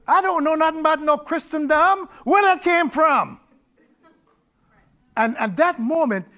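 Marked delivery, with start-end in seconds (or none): 0.1-3.4 higher, louder astonished wondering; amazed exclamation. Real, not acted. Telling the congregation, loud amazed wonder. Marvelling at where the words came from.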